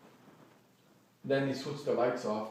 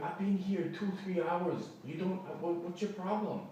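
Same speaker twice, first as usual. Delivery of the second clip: higher-pitched, pleading protest; retold exasperation, voice pushed higher; exasperated protest; 6 semitones higher, 3 dB quieter than usual. Guest pleads with hostel staff, higher exasperated protest.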